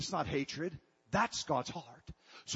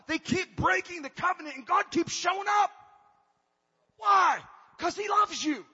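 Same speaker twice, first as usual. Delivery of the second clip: much higher and louder, preaching at full throttle; full-force preaching; 9 semitones higher, 6 dB louder than usual. Acted. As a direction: build the sermon to a fervent peak.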